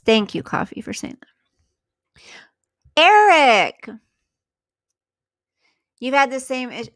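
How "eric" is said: loud high call of the name. Real, not acted. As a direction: call out to greet him. Greeting a viewer by name.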